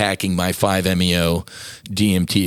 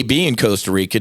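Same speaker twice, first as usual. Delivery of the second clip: ordinary talk, nothing marked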